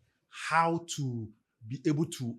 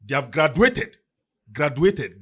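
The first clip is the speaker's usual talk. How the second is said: emphatic; 11 dB louder than usual. Real, not acted. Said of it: "loud emphatic repetition".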